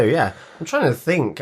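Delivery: usual speaking voice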